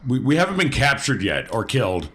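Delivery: ordinary talk, nothing marked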